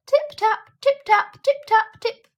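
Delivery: high, bright tip-taps like tiny feet; chorus of tappings. A narrator's reading. Narrator voicing the mice tapping all over the dresser, high.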